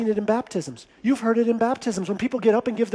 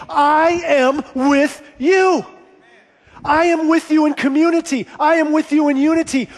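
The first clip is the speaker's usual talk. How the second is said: higher, forceful proclamation as God; 7 semitones higher, 5 dB louder than usual. Acted. God promising his presence to the congregation, preacher's proclaiming voice. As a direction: declare it ringingly on God's behalf. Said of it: "It is God saying".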